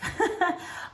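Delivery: high, breathy chuckle; laugh. Real, not acted. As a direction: laugh at your own warning. Jokes about noisy whistles.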